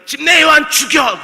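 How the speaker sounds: loud, high shouted command; imitated shout. Herodias demanding John the Baptist's death, high shout. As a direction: act out Herodias yelling for a killing. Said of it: "이렇게 소리를 지르는 겁니다".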